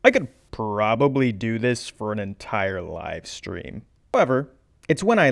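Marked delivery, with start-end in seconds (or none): none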